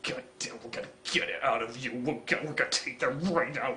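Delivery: low, hushed, hurried grumble; mock seething under the breath; pissed-off muttering. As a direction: rush it out low and quiet. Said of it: "And he looks pissed. He's like".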